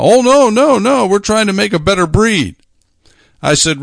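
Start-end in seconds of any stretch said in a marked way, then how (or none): 0.0-2.6 quick defensive "oh no no"; mock protest. Farmers protest, retold.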